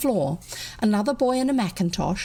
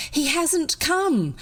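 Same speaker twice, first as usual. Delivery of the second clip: worried father; 7 semitones higher, slower than usual. A narrator's reading.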